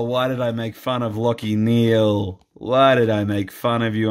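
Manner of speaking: ordinary talk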